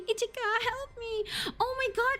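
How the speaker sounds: usual speaking voice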